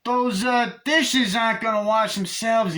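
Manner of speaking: high, loud taunting doll voice; Chucky nagging. Retold or imitated. Chucky taunts Tiffany about the dishes, high and loud. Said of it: "Chucky just turns and goes".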